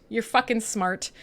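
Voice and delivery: normal talking voice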